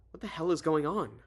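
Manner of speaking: acted alarm, demanding an answer. Heroine grabbing the phone to the president, alarmed.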